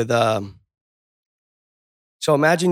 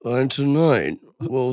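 hammed-up anchor voice; mock newscaster; slower than usual. Mocking the news anchor voice, lightly voiced. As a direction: put on a phony news read. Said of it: "I hate that stupid voice they have".

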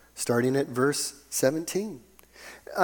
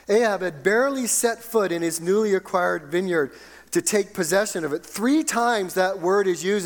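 raised-pitch, emphatic sermon storytelling; animated preaching; 6 semitones higher, 3 dB louder than usual. Real, not acted. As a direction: drive the story forward from the pulpit.

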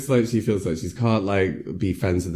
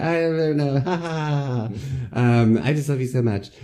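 creepy laugh; 8 semitones higher than usual. Retold or imitated. Boyfriend voiced laughing creepily.

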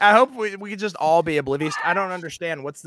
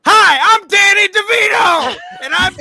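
high, shouted, absurd celebrity impression; Danny DeVito; 13 semitones higher, 8 dB louder than usual. Host imitating his partner's silly impression, high shouting. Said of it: "literally just being like".

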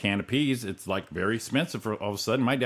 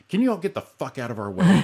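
raised, louder pleasant request; retold mock pleasantry; 7 semitones higher, 5 dB louder than usual. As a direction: say the rude line like a courtesy, higher and louder. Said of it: "his pleasantry with the delivery".

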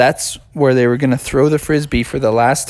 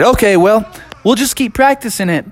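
upbeat agreement; 6 semitones higher than usual. Acted. Friends agree to keep practicing.

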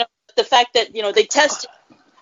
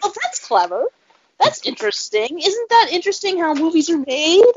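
higher, faux-impressed tone; mock fascinated; 7 semitones higher than usual. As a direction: play up the wonder a bit. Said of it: "And I'm like".

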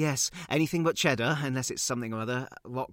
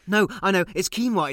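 eager correction; 8 semitones higher, 10 dB louder than usual. Acted. Correcting himself, loud quiz answer.

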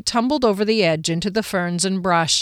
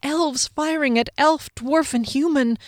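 disbelief; 7 semitones higher than usual. A narrator's reading. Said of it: "in utter disbelief and bewilderment".

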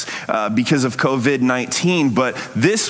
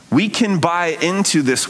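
normal talking voice

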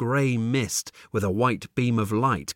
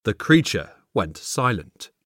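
usual speaking voice

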